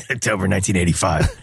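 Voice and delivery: light terse journal-entry voice; a hint of grim vigilante diary; Rorschach voice.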